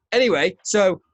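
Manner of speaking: ordinary talk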